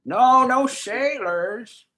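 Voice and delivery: put-on high, creaky old man; wobbly elderly croak. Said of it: "Do an old man".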